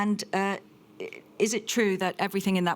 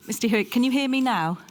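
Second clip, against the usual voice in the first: louder, higher call down the link; raised voice; 5 semitones higher, 6 dB louder than usual. Real, not acted. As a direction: project to be heard remotely. Calling over failing video link.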